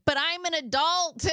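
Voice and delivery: high-pitched wailed objection; mock protest. Exhausted patient objecting to nurses, mock high-pitched protest. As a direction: protest up high, playing up the helplessness.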